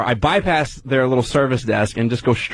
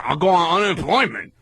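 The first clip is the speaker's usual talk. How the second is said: higher-pitched mock rep; imitating defiant rep; 5 semitones higher than usual. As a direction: toss it back as the unbothered clerk. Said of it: "And then she goes".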